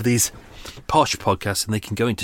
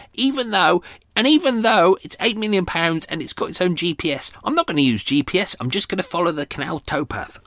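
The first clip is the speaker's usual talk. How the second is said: mocking rich pilot; 8 semitones higher than usual. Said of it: "wankers in the helicopters ... going".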